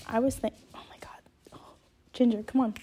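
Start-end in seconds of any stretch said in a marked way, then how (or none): 0.7-1.7 startled whisper. Real, not acted. Distracted by the dog.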